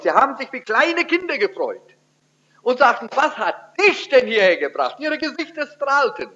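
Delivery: mock childlike delight. Describing the family's delight.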